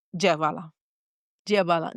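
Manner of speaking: low, resigned plea; grave, falling entreaty; retold surrender in prayer. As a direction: give it up to God, voice dropped. Retold prayer surrendering her baby.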